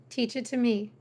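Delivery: ordinary delivery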